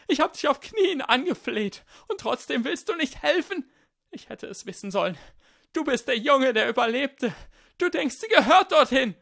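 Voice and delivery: very high, loud screeching accusation; shrieking. Acted. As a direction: screech it furiously till it hurts the ears. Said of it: "seine Stimme erklang als ein Kreischen".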